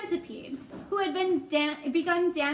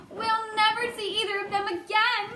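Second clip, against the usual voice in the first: mild distressed cry; 6 semitones higher than usual. Acted. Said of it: "cried the ladybug".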